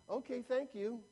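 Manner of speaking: high, soft, polite thanks; small-voiced little lady customer. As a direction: thank them sweetly in a small voice. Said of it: "this little lady".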